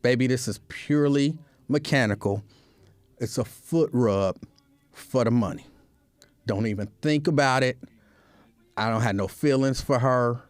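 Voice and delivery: low, calm pretend reassurance; soothing reassurance. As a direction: gently placate your partner, voice dropped low. Reassures partner during imagined foot rub.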